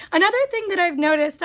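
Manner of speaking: ordinary talk, nothing marked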